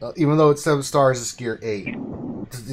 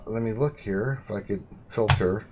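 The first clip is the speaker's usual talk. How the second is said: ordinary delivery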